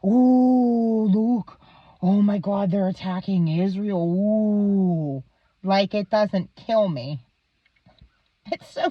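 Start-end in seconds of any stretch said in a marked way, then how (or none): 0.0-5.2 mock dad watching news. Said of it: "and watch and go".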